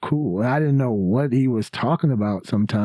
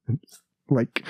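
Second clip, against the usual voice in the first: ordinary talk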